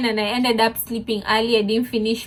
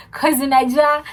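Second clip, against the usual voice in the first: ordinary delivery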